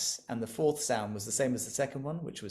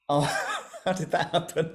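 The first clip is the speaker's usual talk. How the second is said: laughing surprise; 5 semitones higher, 5 dB louder than usual. Real, not acted. Zoom reaction surprises teacher.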